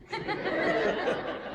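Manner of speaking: airy laugh; a last breathy chuckle; breathless laugh. Real, not acted. Laughing at the end.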